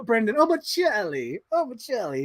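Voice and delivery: high, hammed-up Italian accent. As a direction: put on a mock Italian accent, pitched high. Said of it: "in an Italian accent".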